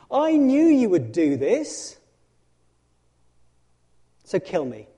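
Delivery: slow, loud bitter outburst, pitched high; exasperated Jonah. Acted. Jonah complaining bitterly to God, slow loud exasperation. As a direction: spit it out at God in disgust.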